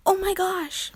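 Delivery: high excited squeal. A narrator's reading. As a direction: squeal with delight. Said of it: "Mia squealed".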